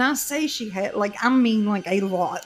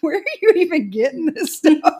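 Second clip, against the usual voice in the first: laughing; 8 semitones higher, 6 dB louder than usual. Retold or imitated. Friends laughing at condiment stash.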